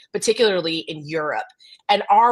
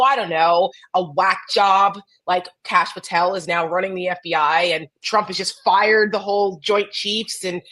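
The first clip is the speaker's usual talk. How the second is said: ordinary talk, nothing marked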